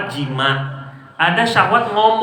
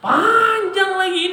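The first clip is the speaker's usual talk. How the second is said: mock exasperation; 12 semitones higher, much slower than usual. Complaining about long sermon.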